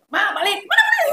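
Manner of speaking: a high, laughing call of balik; urgently calling mom home, half-laughing; excited calling. Retold or imitated. Calling her mother home, excited and laughing.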